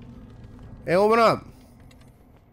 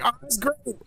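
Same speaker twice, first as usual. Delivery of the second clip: mock delight; 11 semitones higher, 4 dB quieter than usual. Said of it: "everybody's like".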